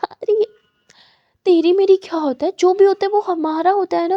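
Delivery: high, loud protesting plea. Acted. Aadya pleading loudly with angry Muskan, high and loud. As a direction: insist loudly it's all shared.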